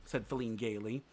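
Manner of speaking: ordinary delivery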